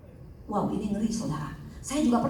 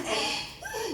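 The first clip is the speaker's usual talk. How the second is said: imitated startled cry; 11 semitones higher, 3 dB quieter than usual. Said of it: "dia teriak".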